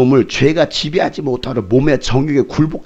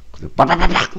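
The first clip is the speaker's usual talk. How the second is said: smash sound effect; 4 semitones higher than usual. Retold or imitated. Imitated phone smashing sound.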